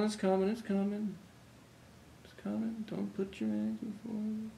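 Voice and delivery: very soft, distracted mumbling; barely audible filler while hunting for something; muttering while searching. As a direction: mumble under your breath, stalling for time. Stalling while searching for proof.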